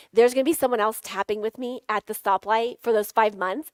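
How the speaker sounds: ordinary talk, nothing marked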